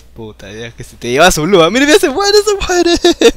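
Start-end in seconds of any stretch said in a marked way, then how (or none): 1.7-3.3 high, loud, thrilled shouting; excited yell. Real, not acted. Player watching enemy nearly die, excited yell. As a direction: shout as the kill gets close.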